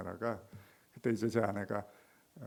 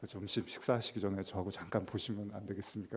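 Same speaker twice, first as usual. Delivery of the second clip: quiet private request; 4 semitones lower, 9 dB quieter than usual. Acted. Pastor privately asking congregant.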